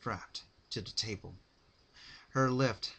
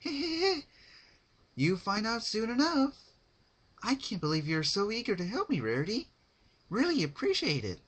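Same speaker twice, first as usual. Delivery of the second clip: hint at a secret through giggles; high pony laughing at a private joke; sly giggly Pinkie; 13 semitones higher, 5 dB louder than usual. Acted.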